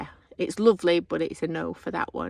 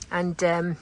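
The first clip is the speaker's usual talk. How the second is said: usual speaking voice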